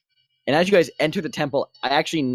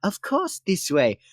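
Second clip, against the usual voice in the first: helpful devotee; 4 semitones higher, slower than usual. Acted. Devotee answering player in character.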